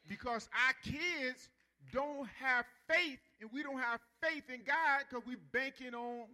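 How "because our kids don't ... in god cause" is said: slow, loud, rising preacher's call; full-force preaching. Real, not acted. Pastor preaching to his church, slow and loud. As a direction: drive it home to the congregation.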